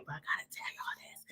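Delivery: near-whispered, rushed confidential aside; hushed aside. Real, not acted. Host confiding to the viewers, hushed aside. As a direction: drop quickly to a whisper.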